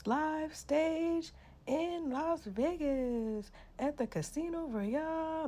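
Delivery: slow, drawn-out stage announcer voice; mock announcer. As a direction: announce it like a grand Vegas host. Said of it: "you trying to be like".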